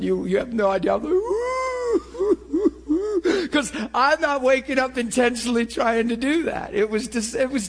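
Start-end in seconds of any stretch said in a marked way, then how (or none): 1.2-3.5 high, drawn-out wail of uncontrolled bawling; exaggerated wailing sob, broken at the end; mock bawling. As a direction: act out a long howling sob. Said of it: "And I'm bawling".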